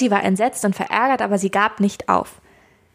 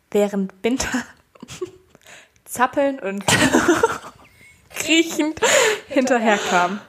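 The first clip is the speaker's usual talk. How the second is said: laughing; 2 semitones higher than usual. Real, not acted. Reading story, cracks up.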